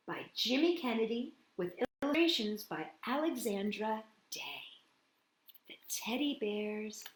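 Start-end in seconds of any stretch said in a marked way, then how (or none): none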